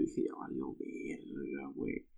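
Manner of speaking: a hushed whisper of defeat; retold whisper that it's all over; whispered to himself. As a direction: whisper it like a private thought.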